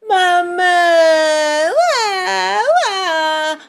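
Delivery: put-on loud, high toddler wail; hammed-up baby crying, drawn out. A narrator's reading. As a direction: bawl for mum in long wails. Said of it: "llora Richard".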